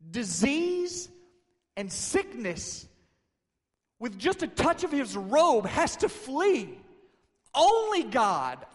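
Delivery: high, loud, slow cry of amazement; astonished disciples. Retold or imitated. Disciples react to a healing by touch, loudly astonished. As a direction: act out awestruck disbelief at full voice. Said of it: "slow disciples probably had to say".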